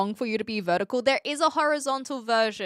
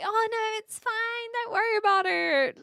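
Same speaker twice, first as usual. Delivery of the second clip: higher, overly sweet brush-off; mock polite refusal; 11 semitones higher than usual. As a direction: pretend to wave off the money, sugary. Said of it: "But I'm not gonna be like".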